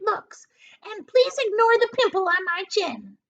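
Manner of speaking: high, loud, sneering aunt; high mocking hag voice; Aunt Spiker's voice. A narrator's reading. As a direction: jab at her sister in a high voice. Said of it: "Aunt Spiker said".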